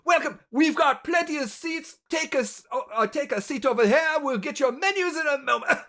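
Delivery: high, loud, bustling welcome; full-on happy host. Acted. Innkeeper seating new guests, loud and happy. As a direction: hurry guests to their seats with big cheer. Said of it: "full-on happy person".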